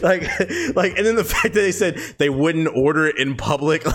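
high, amused, still laughing; talking through laughter. Real, not acted. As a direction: squeeze the words out between laughs. Talks through laughter at story.

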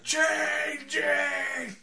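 high, loud drawn-out movie shout; hammed-up horror cry, held long; changing wolf man. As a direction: wail it like a B-movie monster transforming. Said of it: "they just call it The Changing".

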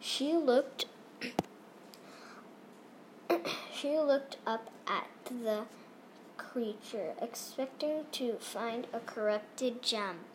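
soft, hushed spooky narration; hushed suspense. Acted. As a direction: drop to a hushed whisper for suspense. Hushed climax of story.

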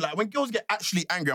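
ordinary talk

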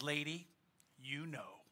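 very soft, low deadpan punchline; hushed, knowing insinuation; subdued parrot. Retold or imitated. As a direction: say it under your breath, as the chastened parrot.

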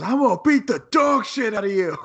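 high, loud mock tough-guy threat; imitating a wrestler's threat. Retelling the match, imitating Jey Uso's loud threat. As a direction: ham up a wrestler's trash talk. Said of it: "Jey Uso just goes".

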